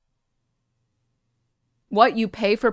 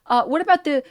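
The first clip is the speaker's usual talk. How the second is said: whiny, higher voice of a deflecting critic; mocking deflection; 5 semitones higher than usual. Mocking the critics who deflect to China, high whiny voice.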